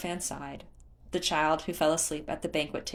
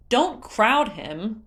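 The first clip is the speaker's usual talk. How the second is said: slow, loud, higher firm warning; 5 semitones higher, 7 dB louder, much slower than usual. Acted. Leto warning Tula off the prince, slow and firm. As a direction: hold her back with each word. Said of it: "Leto warned".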